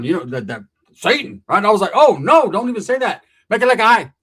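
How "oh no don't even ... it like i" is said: loud, high, mock-horrified refusal; alarmed protest. Storyteller hears Satan named, mock-alarmed protest. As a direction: cut him off in mock alarm.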